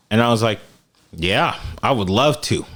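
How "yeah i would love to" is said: usual speaking voice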